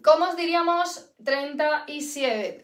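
ordinary talk

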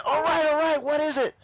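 very high, loud acted yell; frantic surrender. Burned friend gives in to convert, frantic acted surrender. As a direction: yell as if giving in under pain.

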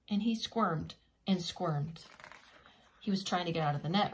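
lively storybook reading voice; reading to children. A narrator's reading. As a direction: read it like a bedtime picture book.

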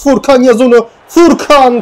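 high, rising urging of the striker; will the shot into the goal; excited high call to a player; urgent cheering. Real, not acted.

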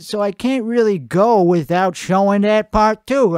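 normal talking voice

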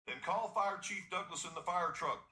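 high, loud urgent shout; farmer shouting. Acted. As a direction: yell for the tractor in a hurry. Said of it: "the farmer shouted".

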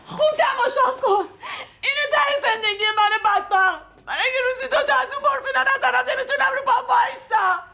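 crying yell, bitter and raw; shouting through tears. Acted. Addict shouting at her friend to look at her ruin, crying. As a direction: hurl it at her while sobbing.

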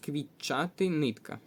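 ordinary talk

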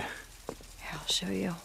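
hushed whisper. Acted. Sarah whispering to Deacon while sneaking in. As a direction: breathe it so nobody hears.

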